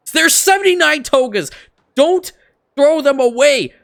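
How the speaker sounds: high, loud furious rant building to yelling; angry rant. Real, not acted. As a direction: rant at the game, fully fed up. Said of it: "That, that was my rant done".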